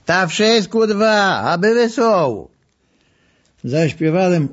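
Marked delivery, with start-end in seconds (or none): 0.1-2.5 Lwów dialect. Retold or imitated. Old man in Lwów dialect.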